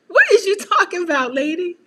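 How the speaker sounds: high, loud, baffled question breaking into a laugh; mock baffled daughter. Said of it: "I be like".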